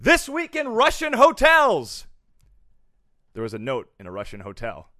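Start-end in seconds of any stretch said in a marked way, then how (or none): 0.0-2.0 announcer voice. Acted. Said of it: "announcing a segment title".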